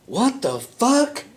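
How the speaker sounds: drawn-out high startled what the fuck; mock startled yell from the bathroom; shocked outburst. Hears shocked cry from bathroom.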